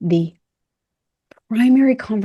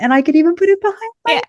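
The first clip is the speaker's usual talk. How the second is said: much higher and louder, fast and gushing; real delight; 11 semitones higher, 5 dB louder, faster than usual. Real, not acted. Showing off her recovered arm to co-host, fast gushing delight. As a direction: race through it, thrilled. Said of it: "Makes me very excited".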